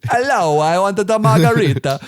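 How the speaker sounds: put-on weird English-abroad accent. English tourist ordering abroad, mock weird accent. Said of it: "with a weird accent".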